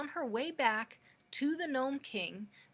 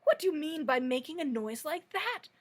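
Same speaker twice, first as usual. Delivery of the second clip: louder, higher angry shout at the hen; 5 semitones higher, 7 dB louder than usual. Acted. The king shouts angrily at the cackling hen. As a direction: scold the hen sharply. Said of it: "shouted the king angrily".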